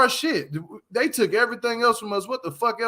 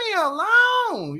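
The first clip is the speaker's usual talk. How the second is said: very high, drawn-out wail; put-on falsetto, holding the words long; high, stretched mock cry; blazing voice; 14 semitones higher, slower than usual. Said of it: "In my blazing voice".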